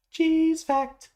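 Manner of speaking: high, drawn-out mock jingle; slow, high-pitched segment intro, hammed up; jingle announcer. Mock soundboard jingle for cheese fact.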